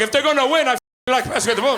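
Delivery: Portuguese dad. Retold or imitated. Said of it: "He's like".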